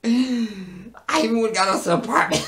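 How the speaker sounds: high, loud plea breaking into laughter; hammed-up aggrieved plea. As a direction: wail it mockingly, barely holding back laughs. Mocking Justin's plea, breaking into laughter.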